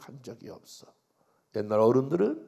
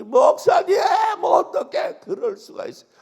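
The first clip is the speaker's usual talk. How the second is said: much higher and louder, a crying outburst; wailing shout; 14 semitones higher, 16 dB louder than usual. Retold or imitated. Deaconess bursting through the door to the pastor, crying shout. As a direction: shout it through tears, hitting 뭐. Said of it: "막 그러면서 통곡을 하고 울어".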